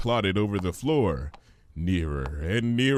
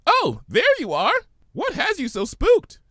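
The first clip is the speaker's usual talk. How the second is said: very high, giddy sing-song; bubbly Pinkie voice; 6 dB louder than usual. Acted. Pinkie playing with words at the market, high and giddy. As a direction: bounce on every syllable.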